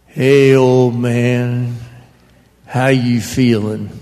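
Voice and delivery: a hint of a rough good old boy, drawn out. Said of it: "as redneck as they come, and he goes".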